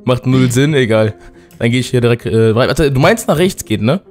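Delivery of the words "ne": ordinary talk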